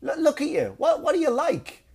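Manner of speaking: very high, incredulous telling-off; exasperated sergeant. Acted. Sergeant to out-of-shape officer, exasperated. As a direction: pitch it way up in mock disbelief.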